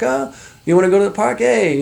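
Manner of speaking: put-on Canadian accent. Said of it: "speaking with an accent like the Canadians".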